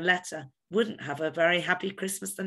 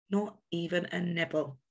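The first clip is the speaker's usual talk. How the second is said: ordinary delivery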